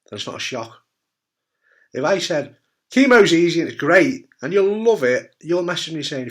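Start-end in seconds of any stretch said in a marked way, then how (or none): 2.9-5.3 loud, high fake sales pitch; mock enthusiasm. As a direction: oversell it with false cheer. Mock claim he says he'd never make.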